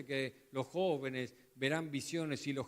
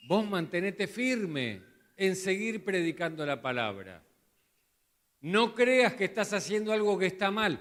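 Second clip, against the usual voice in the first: much louder, higher, fervent exhortation; full-force preaching; 4 semitones higher, 9 dB louder than usual. Acted. Preacher as Paul urging Timothy to stand firm, fervent. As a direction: shout the charge to stand firm.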